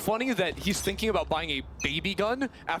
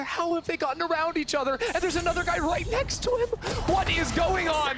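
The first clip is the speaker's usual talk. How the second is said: excited; 11 semitones higher than usual. Real, not acted. Caster reacts to surprise play.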